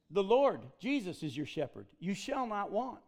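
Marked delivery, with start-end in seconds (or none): none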